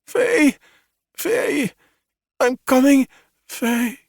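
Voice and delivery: high, fading drowsy calling; sedated woman calling her husband; sedated mumbling. A narrator's reading. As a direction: call out as the drug takes hold. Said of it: "still mumbling".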